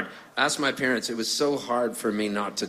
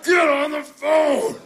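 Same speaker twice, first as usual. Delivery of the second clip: high, slow, loud yell across the house; drawn-out calling-out in dad's voice; imitated dad hollering; 5 dB louder, much slower than usual. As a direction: holler for your wife to pick up. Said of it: "And then he goes".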